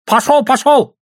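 very high, loud dismissal; angry shout. A narrator's reading. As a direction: yell at them to clear off. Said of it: "закричал Сашка".

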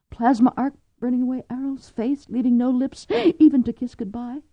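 frightened wife picturing her husband's death; Cordelia afraid. A narrator's reading. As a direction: spiral into dread. Cordelia voicing her fears.

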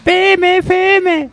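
squeaky silly echo; high squeaky voice. Pretend. High mocking echo of Pepito. (voz aguda burlona)

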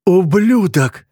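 high, slow, venomous curse; furious name-calling, pitched high; spat insult. A narrator's reading. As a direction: spit the word out at him. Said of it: "выплюнул он".

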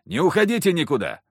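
loud call through a door. Acted. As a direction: shout it to the door.

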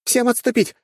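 high, loud female command; screamed order. A narrator's reading. Young commander to troops over headsets, screamed retreat order. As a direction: shout the retreat into their headsets. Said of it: "прокричала девушка, едва не оглушая людей".

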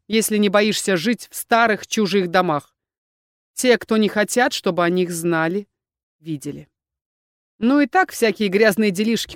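ordinary delivery

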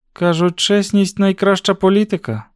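high voice, a touch hard; slightly callous tone. A narrator's reading. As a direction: put him off with mild coldness. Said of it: "черствуватим тоном сказала вона". (трохи черствуватий тон)

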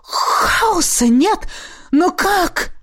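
high, drawn-out astonished gasp; gasped amazement. A narrator's reading. Revived man looking round at the vanished chaos, amazed. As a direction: gasp it out in disbelief. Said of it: "огляделся и ахнул".

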